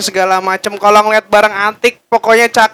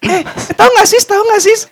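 put-on excited shopper girl; 10 semitones higher than usual.

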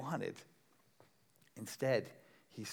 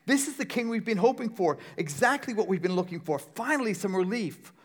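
excited crowd; 7 semitones higher, 6 dB louder than usual. Retold or imitated. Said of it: "he said".

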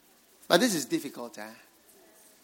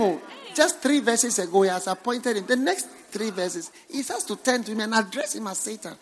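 ordinary talk

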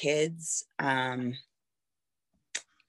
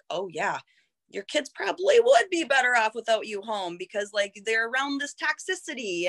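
mocking inner critic, pitched higher; 8 semitones higher than usual. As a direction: sneer it like the cruel voice in her head. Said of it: "I can go dark quick".

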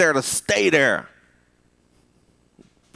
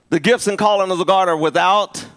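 much higher and louder, driving preacher climax; preaching at full force; 10 semitones higher, 4 dB louder than usual. Acted. Preacher warning the congregation they must still pray, at full force. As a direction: whoop it up, lifting the church.

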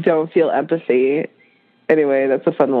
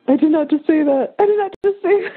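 shocked laughing; 10 semitones higher, faster than usual. Retold or imitated. Retelling her shock, laughing.

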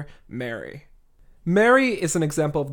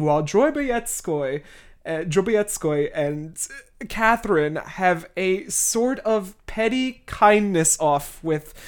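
ordinary delivery

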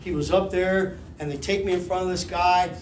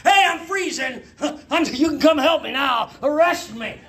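high, loud mock plea; calling for help; 8 semitones higher, 4 dB louder than usual. Man in the river wanting a cop, high mock holler. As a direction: holler to the cop to rescue you.

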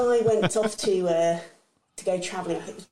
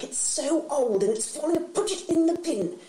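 a hint of a nagging mother, pitched up; mock mother; 6 semitones higher than usual. Mother telling him to bin the sleeper suit, nagging high. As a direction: scold lightly, rising high. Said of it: "She's like".

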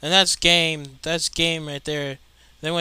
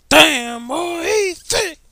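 much higher and slow, stretched mock hype; drawn-out meme catchphrase; 10 dB louder, much slower than usual. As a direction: drag each word out, hamming up the meme.

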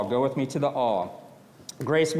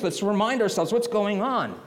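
ordinary talk, nothing marked

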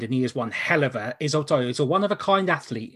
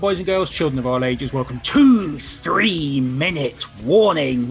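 ringmaster; 5 dB louder, slower than usual. Acted. Grand show opening to audience.

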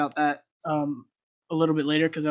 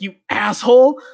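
retold curse; 4 semitones higher, 10 dB louder than usual. Friend cursing at the cop.

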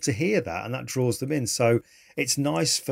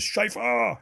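retold shout of a name, much higher; mock shout; 11 semitones higher than usual. Veteran calling across the party, mock shout. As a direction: call the name across the room. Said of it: "He shouts it out".

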